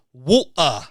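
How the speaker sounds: Walter drawn out slowly as Volter, mock German; German-accented Walter. Shown as a demonstration. Host saying the wrestler's name in a put-on German accent. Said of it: "I do a terrible accent".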